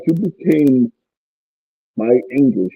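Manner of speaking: ordinary talk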